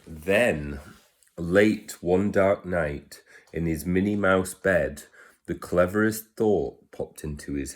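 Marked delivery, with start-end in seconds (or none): none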